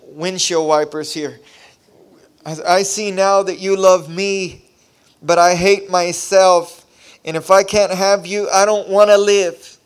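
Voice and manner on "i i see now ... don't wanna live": slow, louder, broken-hearted confession; desperate plea. Retold or imitated. Crying man confessing to God, slow desperate plea. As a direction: pour it out as if through tears. Weeping prayer of surrender.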